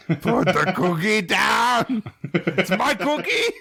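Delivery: high, slow monster growl; Cookie Monster voice. Retold or imitated. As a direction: bellow it like a greedy monster. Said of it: "that Cookie Monster voice".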